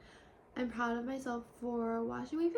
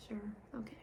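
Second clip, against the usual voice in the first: breath-only, doubting herself; whisper; 8 dB quieter than usual. Real, not acted. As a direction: whisper it as if you don't believe it. Self-doubting aside.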